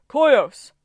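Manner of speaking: a Titan crowing his name. Acted. Titan repeating his name louder.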